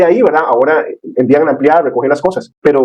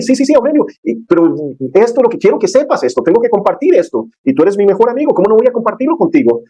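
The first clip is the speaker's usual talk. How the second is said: high, eager and insistent; can't hold back the good news; excited; 8 semitones higher than usual. Retold or imitated. Man insisting to his best friend he must share this, excited.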